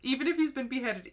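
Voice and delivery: high laughing delight. Real, not acted. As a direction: giggle through the line. Laughing comment on story.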